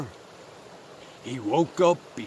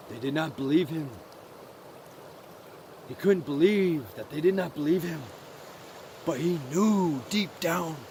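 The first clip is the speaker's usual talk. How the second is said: soft, hushed storytelling with long pauses; subdued, sad narration; 3 semitones lower, 8 dB quieter than usual. A narrator's reading. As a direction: let the voice sink with his heart. Narrator tells of mouse's heartbreak.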